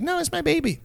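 high, soft mock-mom voice; squeaky-high, babying protest; doting mom. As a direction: imitate a mother cooing over her pet. Mimics mom doting on dog.

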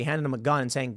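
ordinary talk, nothing marked

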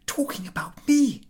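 high, hushed suspicion; anxious inner whisper. A narrator's reading. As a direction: suspect them in a whisper. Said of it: "thought Ahiniv".

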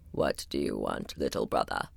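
very low rumbling wall; grumbling wall. Acted. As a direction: grumble from deep down like stone. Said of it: "grumbled the wall".

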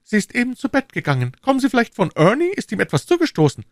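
hoch, schnell und besorgt; besorgte junge Frau. A narrator's reading. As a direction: hastig und beunruhigt fragen. Lizzie worried about Ernie. (besorgt, hohe schnelle Frauenstimme)

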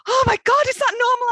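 a nervous flyer mid-lurch, a little hammed-up; panicked passenger. To the stranger as the plane drops, mock panic. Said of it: "and he went".

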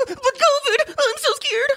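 very high, mock frightened whimper; mock Democrat whimpering about covid; mock scared whine. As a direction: parody a terrified Democrat. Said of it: "start crying and shaking".

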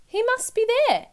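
very high, eager squeal; excited squeal. A narrator's reading. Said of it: "they squealed".

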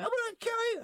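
very high, loud panicked screech; retold terrified threat. Cornered man with keys in knuckles, acted panicked screech. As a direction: act out threatening in pure terror. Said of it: "I'm terrified for my fucking life".

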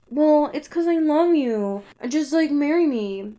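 high-pitched, imitated lover's plea; mock lovesick pleading. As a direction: plead as a hammed-up lovestruck suitor. Imitating his pleading declaration of love.

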